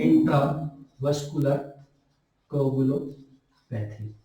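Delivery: slow, soft teacher dictating intravascular coagulopathy; slow dictation. Shown as a demonstration. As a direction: spell out intravascular coagulopathy slowly for notes. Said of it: "term repeated slowly for dictation".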